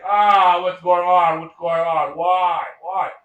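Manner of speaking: loud opening wail, easing off; mock crying. He starts crying to Daddy over the defeat, mock wailing. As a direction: act out a loud, pleading lament. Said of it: "He starts crying to Daddy".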